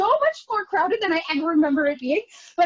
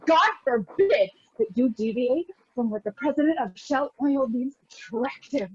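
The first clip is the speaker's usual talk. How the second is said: ordinary delivery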